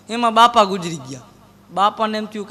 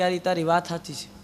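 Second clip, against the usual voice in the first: low, soft deadpan sigh; mock glum agreement; 5 semitones lower, 8 dB quieter than usual. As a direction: agree quietly, sad-sack style. Gloomy friend agrees.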